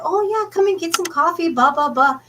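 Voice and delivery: bright, high, welcoming hostess voice; sing-song hotel owner greeting; friendly innkeeper. Retold or imitated. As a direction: play the cheery host inviting them for coffee. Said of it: "she's like".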